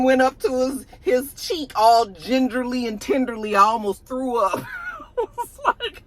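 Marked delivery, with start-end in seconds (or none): 4.6-5.1 high squealing burst of laughter; shrieking laugh. Real, not acted. Host reacting to the kiss, with a high squealing laugh.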